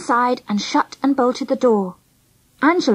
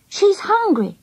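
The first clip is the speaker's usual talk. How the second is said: high, cross complaint; 8 semitones higher, slower than usual. A narrator's reading. Friend blaming Angela for the hungry baby, cross. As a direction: say it crossly, pitched up. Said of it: "I said crossly to Angela".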